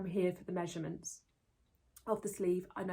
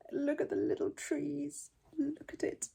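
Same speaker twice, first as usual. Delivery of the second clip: cooing delight; 7 semitones higher, slower than usual. Real, not acted. Cooing over sweater detail.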